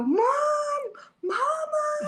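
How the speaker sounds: high calling yell of mama; child yelling mom. Retold or imitated. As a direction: yell for mom like a lost toddler. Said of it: "and Maverick would go".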